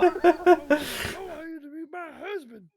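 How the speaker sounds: high, slow wailing ghost voice. Pretend. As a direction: moan it like a lovesick ghost.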